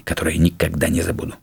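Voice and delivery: ordinary delivery, nothing marked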